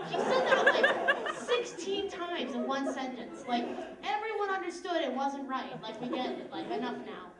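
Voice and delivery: normal talking voice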